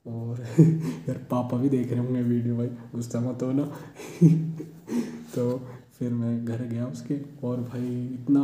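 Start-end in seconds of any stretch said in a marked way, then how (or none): 0.5-1.0 short breathy chuckle; brief amused laugh; real chuckle. Real, not acted. Laughs at own story. 3.7-5.3 high, breathy giggle; spontaneous high laugh; real giggle. Real, not acted. Laughs about father seeing the video.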